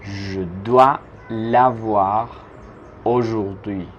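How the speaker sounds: Je dois l'avoir drawn out slowly; slow chunked. Shown as a demonstration. Teacher spelling out the sentence slowly for students. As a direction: dictate it in slow pieces. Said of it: "teacher builds the sentence".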